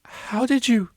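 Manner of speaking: high, startled splutter. A narrator's reading. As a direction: blurt it out in shock. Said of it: "spluttered the cellist".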